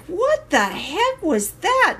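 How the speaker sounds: a retold what-the-heck moment, high and drawn out; startled puzzlement. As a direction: ask it like the sound just startled you. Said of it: "I'm like".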